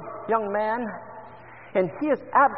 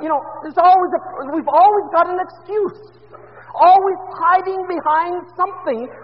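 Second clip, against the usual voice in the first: fervent preaching; 8 semitones higher, 8 dB louder than usual. Acted. Preaching at full force.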